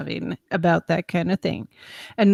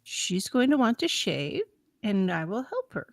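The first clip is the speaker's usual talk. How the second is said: firm patience; 7 dB quieter than usual. Retold or imitated. Her firm calm answer retold.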